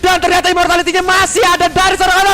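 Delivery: fighting game announcer. Real, not acted. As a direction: scream the kill call. Casters call a triple kill.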